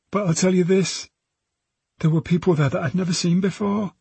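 put-on high girl's voice, opening up; young witness voice, pitched high; frightened teenage girl. Girl tells police about strangers, frightened but confiding.

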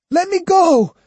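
high, loud desperate plea; begging. A narrator's reading. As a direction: beg in pain. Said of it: "he begged".

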